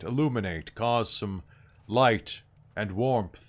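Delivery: solemn medium. Acted. Medium leads seance.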